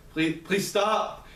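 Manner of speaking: high, very slow pleading from a voice in pain; desperate begging. A narrator's reading. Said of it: "the faint sound of someone begging".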